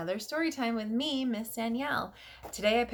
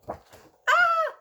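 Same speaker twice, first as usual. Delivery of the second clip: mock scream; 8 dB louder than usual. Shocked at the end.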